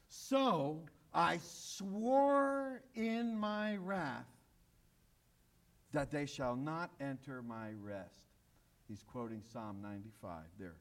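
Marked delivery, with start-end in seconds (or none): 0.1-8.1 slow solemn oath. Acted. Reading God's oath in Psalm.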